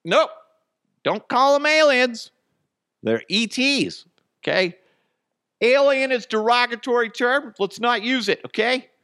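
very high, loud mocking voice; put-on high-pitched protest; mock scolding objector. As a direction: squeal out a fussy correction, hammed-up. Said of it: "I'm not gonna be like".

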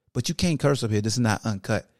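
a calm voice telling him off for cursing; scolding voice. Pretend. The clean voice scolding Petty Hawk for cursing, low and soft.

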